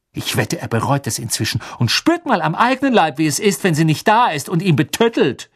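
high, spiteful satisfaction; indignant scolding. Acted. Hermine wishes Crouch suffers without his elf, indignant.

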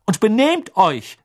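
high, slow, loud call; called after. A narrator's reading. Mother calling after the departing twins, high and slow. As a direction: call it after them as they leave. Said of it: "rief sie den Zwillingen nach".

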